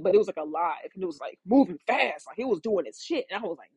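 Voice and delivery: ordinary delivery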